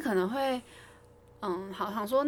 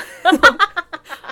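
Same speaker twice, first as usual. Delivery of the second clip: loud, bright burst of laughing; 8 semitones higher, 16 dB louder than usual. Real, not acted. Teacher laughing loudly after quoting her retort. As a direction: laugh hard at your own line.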